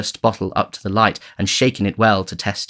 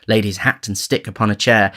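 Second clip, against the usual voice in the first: ordinary delivery, nothing marked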